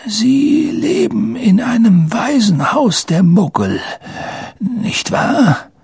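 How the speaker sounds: put-on high, thin old man's voice; frail old headmaster. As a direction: feeble old wizard gently checking a fact. (hohe, gebrechliche Greisenstimme)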